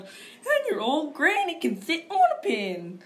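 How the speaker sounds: high, slow taunting pig yell. Retold or imitated. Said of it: "third little pig yelled".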